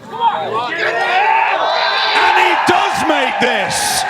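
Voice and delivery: loud, rising call as the putt drops; excited shout. Real, not acted. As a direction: yell it out as it goes in. Putt drops, commentator calls it.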